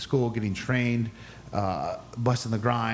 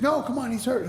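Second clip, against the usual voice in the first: high-pitched pleading referee; urgent protest; 13 semitones higher than usual. Retold or imitated. Referee protests that he's hurt.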